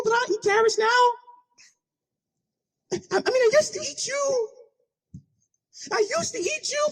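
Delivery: very high, whiny falsetto snake; hammed-up wheedling for rescue; pleading snake. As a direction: bargain desperately in a squeaky high voice. Trapped snake bargains with rabbit.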